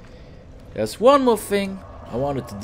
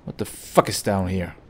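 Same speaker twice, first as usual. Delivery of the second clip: ordinary delivery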